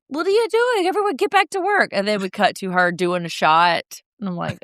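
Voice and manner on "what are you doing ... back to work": very high imitated boss voice; bossy mock scolding. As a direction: order staff about in a high, hammed-up voice. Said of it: "She's like".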